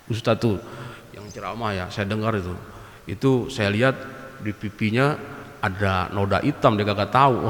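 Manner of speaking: a quiet gossiping murmur about the preacher; gossip hush. Acted.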